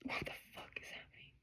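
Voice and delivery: whispered disbelief; real shocked whisper; stunned whisper. Real, not acted. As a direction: breathe it out in shock. Stunned by the reading.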